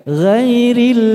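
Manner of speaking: "Ghairil" chanted high with an open ai; long high "Ghairil" with a clear a; light vowel ghairil. Shown as a demonstration. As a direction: sing "Ghairil" as ghai-ril, unrounded. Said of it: "ada yang gairil ya".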